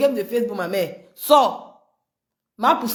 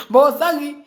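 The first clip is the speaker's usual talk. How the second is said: laughing taunt; 5 semitones higher, faster than usual. Real, not acted.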